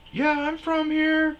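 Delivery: very high, loud panicked squeak; high squeaky self. Retold or imitated. Comic mocking his own answer, high squeaky blurt. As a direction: blurt it in a nervous falsetto.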